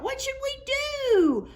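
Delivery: put-on very high piping children's cry; children's voices. A narrator's reading. Narrator voices the worried children, high and piping. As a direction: squeak it out like little kids.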